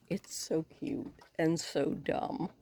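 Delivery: soft unvoiced whisper; hushed so the raccoons stay calm. Real, not acted.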